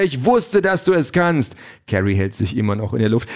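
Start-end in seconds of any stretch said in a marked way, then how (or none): 0.0-1.6 high, elated encouragement; excited cheer; cheering call. A narrator's reading. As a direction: call it out, raised and triumphant. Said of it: "ruft Peter".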